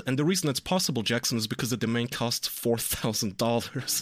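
ordinary talk, nothing marked

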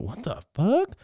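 high-pitched rising question; acted baffled reaction; startled disbelief. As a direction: blurt it high, in shock. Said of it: "I went".

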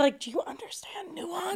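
breathy whispered disbelief; exasperated whisper. Real, not acted. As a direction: drop to a whisper, stunned at the stupidity. Exasperated aside about Tucker Carlson.

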